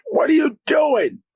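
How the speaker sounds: high-pitched challenging yell; retold shout. Friend confronts him in the street, retold high shout.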